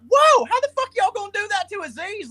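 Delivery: mock outrage. Retelling his reaction to the Me Too photo, mock outrage. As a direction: shriek it like you can't believe it. And I was like.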